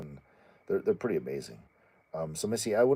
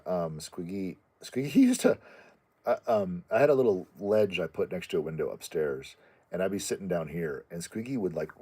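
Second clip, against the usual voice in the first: normal talking voice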